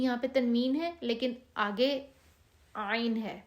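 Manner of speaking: ordinary delivery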